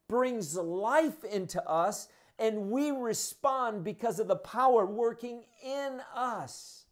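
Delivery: high, ringing preacher's call, slowing on key lines; full-force preaching. Acted. Pastor urging the church toward the Spirit's power, fervent preaching. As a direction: preach it out to the whole room.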